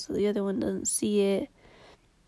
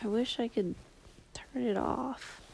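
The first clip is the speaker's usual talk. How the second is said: whispering; 7 dB quieter than usual. Real, not acted. Late-night sad confession.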